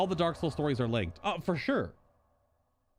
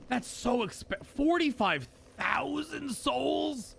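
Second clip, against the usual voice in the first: high, incredulous outburst; shocked disbelief; 11 semitones higher, slower than usual. Real, not acted. Streamer balking at a spell's cost, shocked disbelief. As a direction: drag out the price in high-pitched disbelief.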